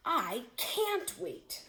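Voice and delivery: high, slow, eager tiger drawing out the words; a hungry tiger who can't hold back; impatient tiger. Acted. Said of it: "said the seventh tiger".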